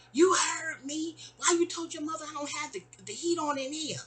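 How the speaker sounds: high-pitched mock indignant landlady; put-on high voice, accusing; yelling landlady impression. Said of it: "And then Margot starts yelling".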